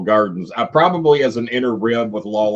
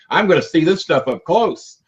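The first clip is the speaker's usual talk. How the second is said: higher-pitched mock nerves, excitement turning to second thoughts; comic retold jitters, pitched up; retold nervous youngster; 7 semitones higher than usual. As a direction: play your young self getting cold feet. Retold fearful self at fourteen.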